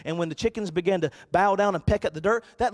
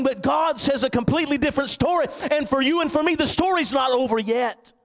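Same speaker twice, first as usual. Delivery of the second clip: higher, rising preacher's cadence; full-force preaching; 7 semitones higher, 4 dB louder than usual. Acted. Pastor rallying the congregation, in fervent preaching. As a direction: lift the church with rising fervor.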